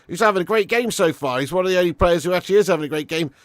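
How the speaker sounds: loud, fast play-by-play over the match; live commentary. Acted. Streamer calling the keeper's save to chat, commentary voice.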